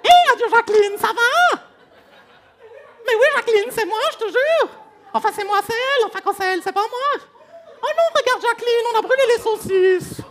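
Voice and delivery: very high, loud squeaky put-on voice; piercing falsetto greeting to an aunt; someone else's voice. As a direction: squeal like a borrowed comic voice. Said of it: "j'en récupère des autres".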